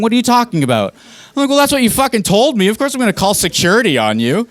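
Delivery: high, outraged disbelief; exasperated. Retold or imitated. Incredulous exasperated reply in quarrel.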